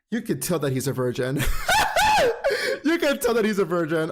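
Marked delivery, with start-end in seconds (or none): 1.5-2.8 shrieking laugh at her own joke; high burst of laughter. Real, not acted. Vlogger cracking up over the virgin joke, shrieking laugh. 2.8-4.1 laughing. Real, not acted. Laughing at own joke.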